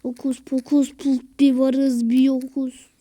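the spell called out loud and proud; loud magic spell. Pretend. Gamer loudly casting his vanishing spell. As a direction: shout the hocus pocus like a stage magician.

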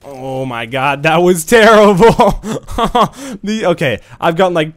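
high, loud laughing disbelief; laughing outburst. Real, not acted. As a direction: crack up at how bad that was. Laughing at own bad play.

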